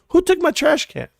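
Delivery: hammed-up cry over the missing trash can; mock outrage. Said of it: "I was like".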